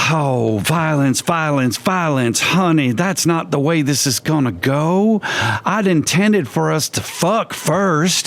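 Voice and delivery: put-on high, slow creepy old man. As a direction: purr it like a leering old man. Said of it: "sort of old man voice".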